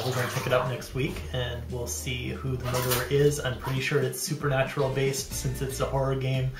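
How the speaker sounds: usual speaking voice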